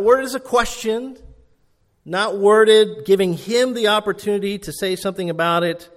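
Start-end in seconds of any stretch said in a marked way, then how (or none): none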